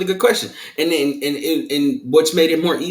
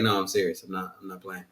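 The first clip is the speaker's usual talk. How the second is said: serious tone; 8 semitones lower, 11 dB quieter than usual. Shown as a demonstration. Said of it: "I'm not sure if they're taking me serious".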